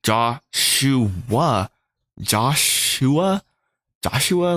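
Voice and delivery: slow, sounded-out name, rising into a question; puzzled. Retold or imitated. Staring at his own name until it looks wrong, puzzled. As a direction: try the name out syllable by syllable, baffled. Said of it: "I remember I was like".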